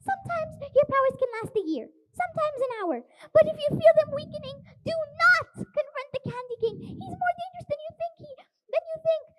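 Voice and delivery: put-on high, loud worried old lady; anxious gran. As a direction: fret over him like a gran. Gran warns Stan urgently.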